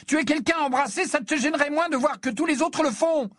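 very high furious tirade; high-pitched raging rant; enraged screaming. A narrator's reading. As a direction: hurl scornful accusations at your brother. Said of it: "hurla Ginny, folle de rage".

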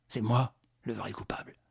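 hushed guilty whisper. Acted. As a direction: breathe the confession under your breath. Lupin's guilty inner thought.

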